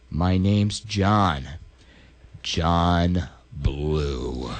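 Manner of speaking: low and drawn out, menacing; deep, slow John Blue. Retold or imitated. Plumber answering Derek, deep and slow. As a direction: drag the name out low. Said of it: "he's like".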